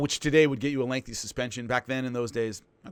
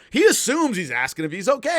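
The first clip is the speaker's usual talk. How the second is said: usual speaking voice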